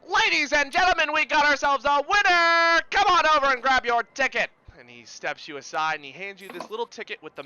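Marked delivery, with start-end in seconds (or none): 0.0-4.5 big, high, shouted winner announcement; carnival announcer. Acted. Carny declaring a winner to the crowd, loud barker's holler. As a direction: trumpet it to the whole fairground.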